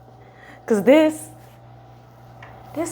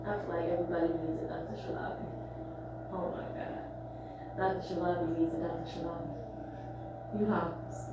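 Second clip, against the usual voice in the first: much softer, near whisper; hushed and drained; 5 semitones lower, 18 dB quieter than usual. Real, not acted. Patient to doctor after relief, hushed and drained. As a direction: barely voice it, spent.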